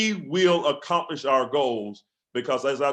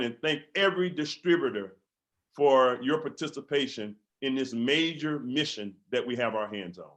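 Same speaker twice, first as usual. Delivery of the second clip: ordinary talk, nothing marked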